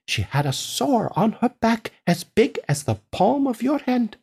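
slightly lilting farmer spinning a tall tale; a hint of a proud farmer; lightly voiced storytelling farmer. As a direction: tell it as a farmer, relishing each detail.

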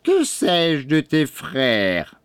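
slow, somewhat high witch's grumble; growling crone. A narrator's reading. Old woman denies knowing the boy's brothers, growled crone voice. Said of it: "grogna la boiteuse".

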